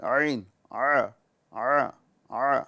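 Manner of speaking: ع squeezed from the middle of the throat, slow; slow throaty ع from the middle of the throat; ain sound. Shown as a demonstration. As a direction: press ع from mid-throat, drawn out. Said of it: "جس کو ہم عین بولتے ہیں".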